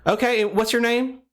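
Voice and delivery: a friendly Southern caller, lightly done. Pretend. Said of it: "we'll go with the Southern voice today".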